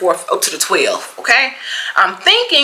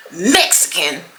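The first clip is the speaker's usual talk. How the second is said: usual speaking voice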